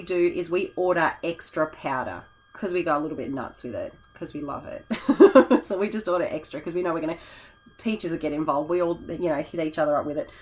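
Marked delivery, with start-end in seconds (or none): 4.9-5.6 short laugh, higher and louder; bright burst of laughter. Real, not acted. Laughing about going a bit nuts with colour powder. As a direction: laugh at your own enthusiasm.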